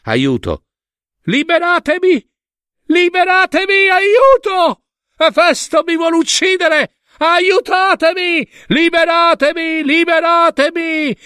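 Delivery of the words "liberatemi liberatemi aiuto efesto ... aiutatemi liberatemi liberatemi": high, desperate screams; frantic full-pitched cries for mercy; pleading for help. A narrator's reading. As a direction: shriek for rescue, stretching the words. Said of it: "non rimase che implorare pietà, aiuto".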